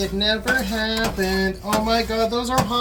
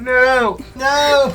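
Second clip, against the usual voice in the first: loud, raised protest; mock dismay; 4 semitones higher, 7 dB louder than usual. Reacting to the pancake prophecy, mock dismay. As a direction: cry out in playful dismay.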